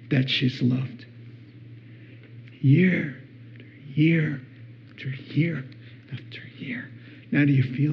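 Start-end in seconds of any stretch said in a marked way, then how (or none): 2.6-6.9 each year softer until it is a whisper; fading to a whisper. Real, not acted. Preacher on a girl never told she's loved, fading to a whisper. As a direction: let the repetition die away into a whisper. Stressing endless years without love.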